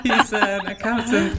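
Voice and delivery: bursting into a loud laugh mid-confession. Real, not acted. Host confessing her invented accountant, laughing. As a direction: laugh it out, embarrassed and delighted.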